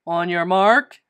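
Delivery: loud coach starting a race; booming race call; bellow. A narrator's reading. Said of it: "bellowed the coach".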